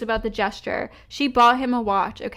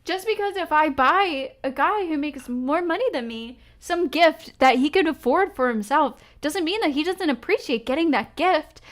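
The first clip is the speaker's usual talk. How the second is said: normal talking voice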